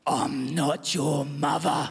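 low, very slow menacing growl; slow, deep horror-movie mum-monster. Retold or imitated. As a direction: drag it out like a creepy monster in the dark. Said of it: "said with this voice".

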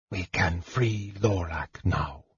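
put-on low-key, soft-spoken young mage; soft and measured; hushed mage. A narrator's reading. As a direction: say it very quietly, with little effort. Weak mage speaks in hushed voice.

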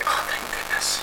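whispered, drowsy relief; sleepy whisper. Retold or imitated. To the driver pausing on the road, a sleepy whisper. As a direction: breathe it out half asleep.

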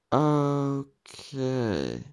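a long, drawn-out hesitant okay. A narrator's reading. Narrator caught off guard by the invitation, drawing out okay. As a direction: stretch the okay out slowly, buying time. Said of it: "sagte ich gedehnt".

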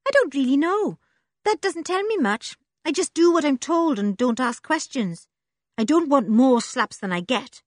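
put-on a scruffy girl who trusts nobody; Joe, urchin girl. A narrator's reading.

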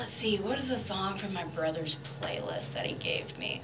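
hushed whisper, thinking aloud; soft unvoiced whisper while looking through a playlist; whispering to herself. Real, not acted. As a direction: murmur it under your breath while searching. Musing to herself on air.

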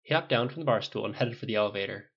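ordinary delivery

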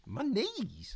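a high squeak of disbelief; can't believe what she heard; shocked disbelief. Acted. Eliza voiced.

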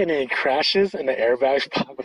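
usual speaking voice

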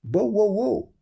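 loud, high put-on bark; dog barking. A narrator's reading. Dog meeting the travellers, loud high barking. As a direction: bark it out in words. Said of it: "says the dog".

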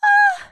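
very high, loud mock moan; a sudden high-pitched "ah" of pleasure. A narrator's reading. Narrator voicing the woman as she climaxes, loud high moan. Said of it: "sie stöhnte laut".

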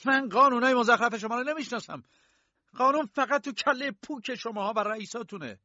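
high, loud contempt for their laws; angry outburst. Acted. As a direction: shout down the guards in disgust. K rages at the guards. (فریاد تحقیرآمیز و عصبانی)

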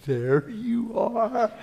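slow, laughing, fond hello to the reflection; slow laughing there you are; fond greeting. Retold or imitated. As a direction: greet your own reflection lovingly. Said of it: "I'll look in the mirror and say".

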